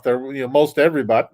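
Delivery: normal talking voice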